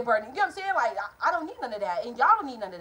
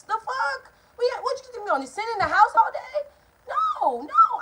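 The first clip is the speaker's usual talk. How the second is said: outraged shout; 11 semitones higher, 4 dB louder than usual. Retold or imitated. Outraged at being told no car.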